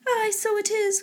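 high, soft mock cry; understated high-pitched exclamation; mother crying out. A narrator's reading. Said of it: "cried her mother".

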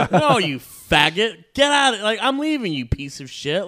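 high, loud furious telling-off; angry yell. Retold or imitated. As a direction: shout him down on the way out. Yelling at date while leaving.

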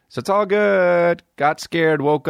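mock cheer. Mocking his own cheer.

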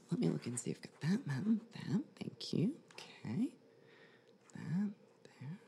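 soft murmured searching; murmuring. Real, not acted. Medium concentrating during reading.

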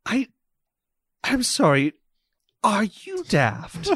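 slow, disbelieving question, pitch climbing; incredulous magistrate. Acted. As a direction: stop the fool with disbelief. Morden, incredulous.

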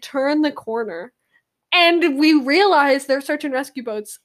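usual speaking voice

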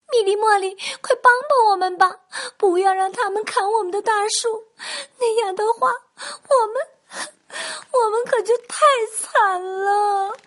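high, loud desperate bird calls; shrill-free but piercing high pleading cries; little birds shouting. Acted. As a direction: cry out as hard as tiny birds can. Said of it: "也使劲儿地喊着".

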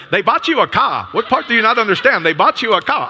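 put-on a Ghana accent, losing patience with her friend; Ghanaian accent, insistent. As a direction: friend retelling Marie, Ghanaian accent and impatient. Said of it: "very to the point".

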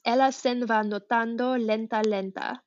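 "Ella sen va nuotando lenta, lenta" read carefully in Italian, "lenta" said twice; reading Italian aloud. Shown as a demonstration. Discussing the poem with a friend, she reads the Italian line carefully. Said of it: "in Italian, it's".

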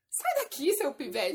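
put-on loud, high yell at a street kid; retold yell. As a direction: shoo him off, shouting. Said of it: "Aí eu olhei pra ele e falei assim".

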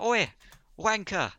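high, loud mock Cockney English accent. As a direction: bark it out in a hammed-up English accent. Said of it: "Amber Heard has an English accent".

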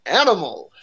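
game show host. Retold or imitated. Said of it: "says his name like a game show host".

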